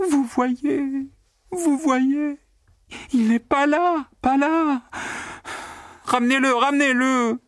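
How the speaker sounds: very high, frantic pleading voice; shrill cry. A narrator's reading. Secretary crying out to the accountant, very high and frantic. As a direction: shriek it out, wringing her hands.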